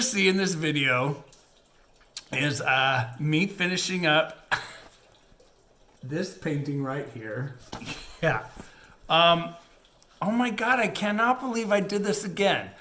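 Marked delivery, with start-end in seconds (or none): none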